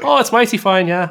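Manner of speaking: mighty fine in a mild Irish accent. Shown as a demonstration. Pleased after praise, still in a light Irish accent. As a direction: keep the light Irish brogue going. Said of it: "that was lovely".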